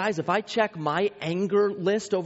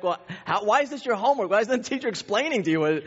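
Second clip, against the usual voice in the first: higher-pitched retold protest; rising, mock-indignant questioning; baffled exasperation; 6 semitones higher, faster than usual. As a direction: act out a baffled dad complaining. Baffled father questions daughter's homework.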